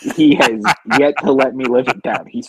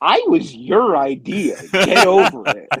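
mock indignation; 7 semitones higher than usual. Mock retort to dad about birth.

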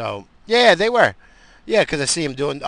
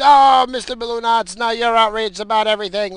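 high, loud put-on voice teasing himself; mocking nickname voice; 12 semitones higher, 12 dB louder than usual. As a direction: sneer at yourself in a silly higher voice.

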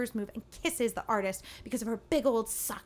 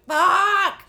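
loud outburst; 6 semitones higher, 10 dB louder than usual. Retold or imitated. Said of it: "And I was like".

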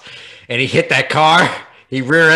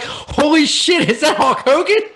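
gawk at a celebrity sighting; imitated amazed onlookers; mock astonishment; 12 semitones higher than usual. Acting out startled smokers in alley.

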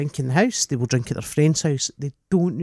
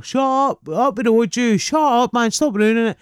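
put-on higher, half-shouted heckle at a drunk; mock crowd shout; 7 semitones higher, 4 dB louder than usual. Comedy crowd telling the heckler to shut up, mock shout. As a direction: call her down like an annoyed punter. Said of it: "already shouting to her".

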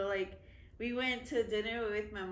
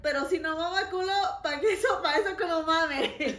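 quoted rap line, louder and higher, laughing; 4 semitones higher, 5 dB louder than usual. Retold or imitated. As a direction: toss off the verse like a fan. Quoting [name] verse.

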